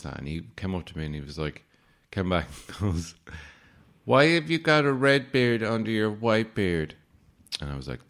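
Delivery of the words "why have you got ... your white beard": put-on higher, louder curious child asking; kid's voice. Kid quizzing the department-store Santa, higher voice. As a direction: squeak it out like a puzzled kid.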